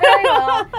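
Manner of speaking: "Very well" high and loud in a Southern twang, laughing; laughing through a put-on Southern "Very well"; laughing mock accent. Host hamming up her own Southern accent. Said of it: "It sounds like a, like an accent".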